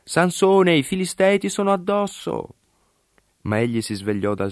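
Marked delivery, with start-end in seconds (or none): 0.0-2.6 calling out high, sharp warning; urgent high shout of alarm; high alarmed cry. A narrator's reading. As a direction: cry out to wake him, pitched way up. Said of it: "Poi gli gridò".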